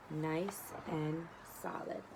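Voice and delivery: very slow, soft, drawn out; slow and satisfied. Acted. Third pig admiring his brick house, extra slow and soft. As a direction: drag it out slowly and softly. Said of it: "going extra slow".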